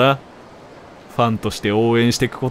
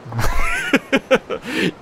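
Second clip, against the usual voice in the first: high laugh; 13 semitones higher than usual. Real, not acted. Laugh at 暇かよ joke.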